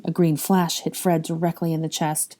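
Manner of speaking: usual speaking voice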